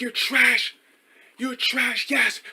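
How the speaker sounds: high-pitched mocking heckle; mock heckler. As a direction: jeer in a high put-on voice. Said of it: "he was heckling me".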